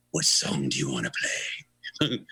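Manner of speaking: very low rock-star impression. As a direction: ask it deep down in the voice. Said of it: "looks up with me and says".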